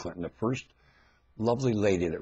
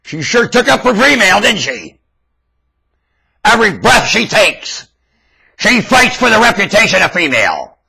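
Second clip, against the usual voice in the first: high and loud throughout; loud ranting praise; 12 semitones higher, 15 dB louder than usual. Real, not acted. Ranter praises Mrs Clinton, full shout. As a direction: proclaim it at full force.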